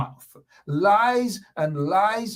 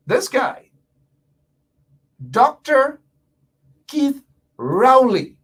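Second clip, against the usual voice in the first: slow, higher and louder, like a ring announcer; grand announcement; 8 semitones higher, 7 dB louder, slower than usual. Acted. Radio host proudly naming the Prime Minister, booming announcement. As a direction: proclaim the name with pride.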